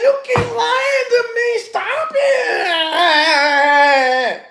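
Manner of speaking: hammed-up tearful nagging; fake crying. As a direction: wail it to guilt him. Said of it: "And she said".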